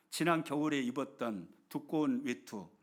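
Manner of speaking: ordinary delivery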